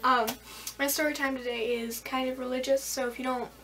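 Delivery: normal talking voice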